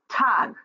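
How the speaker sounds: Tag said high and punched as one strong beat; stressed one-syllable demo. Shown as a demonstration. Teacher models one stressed syllable for the class. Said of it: "have one syllable".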